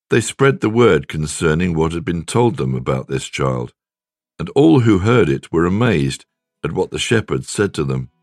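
ordinary talk

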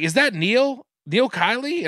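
lightly voiced mom, pitched high; mom's questioning voice. Son retelling mom's mistaken guess, questioning. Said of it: "She was like".

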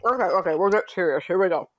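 talking while chewing; words around a bite of yogurt; mouth full. Real, not acted. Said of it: "With a mouthful of yogurt".

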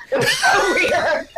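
high, drawn-out laughing disbelief. Real, not acted. Guest tells hosts the odd detail, laughing. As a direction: crack up through the words. Laughing at her own story.

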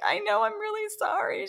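high apologetic put-on voice; apologetic self. Designer apologizing to the fit model, high sheepish voice. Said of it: "And I'm like".

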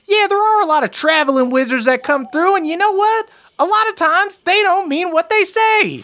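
very high-pitched crowd voice; high, squeaky townsfolk warning; suspicious villager. Acted. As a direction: pipe up high, warning the others.

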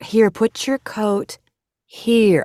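imitating overly careful staff, words stretched out; very slow, gentle. Staff leading stroke survivor back, slow and gentle. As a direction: guide a fragile patient, word by slow word. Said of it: "that same very slow thing".

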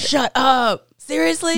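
real loud, high-pitched incredulity; shocked disbelief. Real, not acted. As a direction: react in disbelief, voice shooting up. Host reacts in shocked disbelief.

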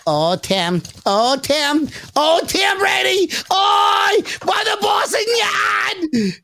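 put-on Boston accent building to a very high shout; mock Boston fan, rising into a drawn-out squeal; New England fan. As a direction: ham up a Boston fan's high-pitched cheer. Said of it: "New England fans. I'm gonna be like".

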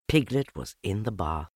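plain reading voice

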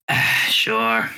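resigned sigh on a drawn-out sure; weary sigh. Acted. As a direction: sigh it out like a tired old matron. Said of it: "she just kinda sighs and goes".

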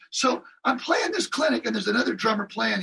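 ordinary talk

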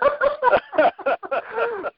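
real high-pitched burst of laughter; long, high, helpless laugh; distinctive laugh. Real, not acted. Telemarketer tickled by his sister being recognised, laughing high. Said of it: "has the same exact laugh".

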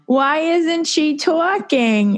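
put-on a small child asking her mom, bewildered; high slow childish wondering; puzzled toddler. Toddler on home video asking mom.

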